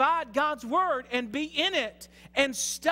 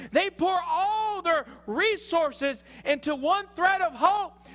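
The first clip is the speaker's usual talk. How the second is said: forceful, raised preacher's voice; preaching at full force; 7 semitones higher, 4 dB louder than usual. Real, not acted. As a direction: drive each phrase home to the congregation.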